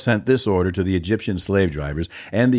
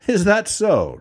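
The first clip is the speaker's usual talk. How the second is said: higher-pitched, challenging comeback from a king; haughty retort; 10 semitones higher, slower than usual. A narrator's reading. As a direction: retort as if scornfully doubting them. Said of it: "retorted Pharaoh".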